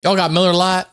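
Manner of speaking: lightly hammed-up Southern accent. Mocking Southern bar patrons.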